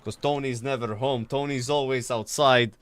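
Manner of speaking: lightly voiced Maltese cop; Maltese accent. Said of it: "Can you R P your character again?".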